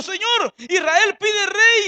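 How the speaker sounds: tearful plea. Acted. Samuel pleads to the Lord.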